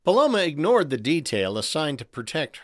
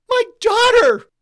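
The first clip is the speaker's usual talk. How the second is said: piercing, very high scream; 12 dB louder than usual. A narrator's reading. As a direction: shriek in terror for your child. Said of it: "the first lady screamed as she was bodily carried out".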